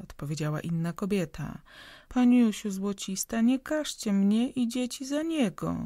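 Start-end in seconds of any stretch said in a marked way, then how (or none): 2.1-5.8 pleading woman. A narrator's reading. Poor woman pleading with landlady.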